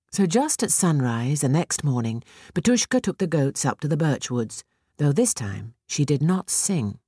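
ordinary delivery

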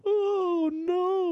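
very high wailing oh no; dramatic dismay. Pretend. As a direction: despair in a high wail. Dismay at new parent.

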